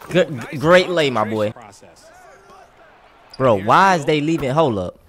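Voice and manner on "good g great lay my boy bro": ordinary delivery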